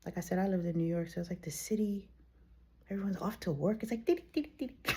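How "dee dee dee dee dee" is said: higher sung dee syllables; bustle noise. Retold or imitated. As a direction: hum the rushing crowd. Imitating city morning bustle.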